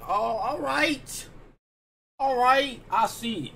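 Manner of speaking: high, drawn-out mock realization; impressed realization. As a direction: re-enact being won over, pitch lifted. Re-enacting his surprised approval of beat.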